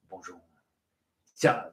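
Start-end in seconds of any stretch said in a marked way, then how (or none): 0.1-0.4 put-on low, soft French-accented greeting; mock French accent, very quiet. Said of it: "Mark, come back with the French".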